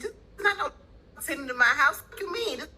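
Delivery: high, cracking angry rant; upset outburst. Real, not acted. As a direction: vent it near tears. Upset rant about squatter.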